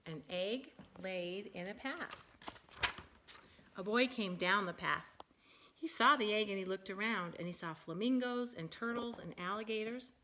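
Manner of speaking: lively, animated picture-book reading; reading to children. Acted. Parent reading a silly bird book to her child, animated. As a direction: read it big and bouncy for a small child.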